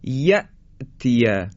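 "یأتیه" slowly, sharp glottal stop after "ya"; still hamza with pause. Shown as a demonstration. Teacher models یأتیه, sharp hamza and pause. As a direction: cut "یأتیه" at the hamza and hold briefly.

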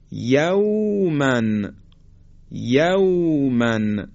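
یومن drawn out, the واو glided softly; slow soft leen demo. Shown as a demonstration. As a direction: stretch یومن gently.

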